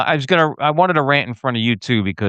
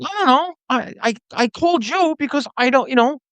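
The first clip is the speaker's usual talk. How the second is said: mimicking the cabbie; 13 semitones higher than usual. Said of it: "I said".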